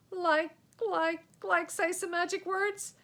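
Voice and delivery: high, loud flustered stammer; panicked stammer. Acted. Fred stammers in panic at the charging knight. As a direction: trip over words in fear.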